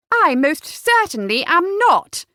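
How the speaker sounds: high, slow, indignant denial; loud, affronted daughter; temper snapping. A narrator's reading. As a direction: refute it hotly, word by word. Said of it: "Cleo's temper finally snapped".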